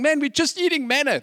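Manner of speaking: high-pitched, acted gripe; mock complaining. Israelites griping to God about their food, hammed-up complaint. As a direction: imitate a fed-up complainer. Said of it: "complain at God and going".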